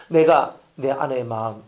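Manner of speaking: ordinary talk, nothing marked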